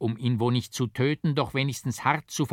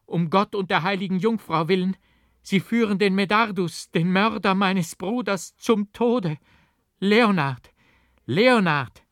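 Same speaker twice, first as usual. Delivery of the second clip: high, loud pleading cries; desperate calling; 9 semitones higher, 6 dB louder than usual. A narrator's reading. Aurelie pleads with Leonard at the window, high desperate calls. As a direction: pull him away, begging him by name.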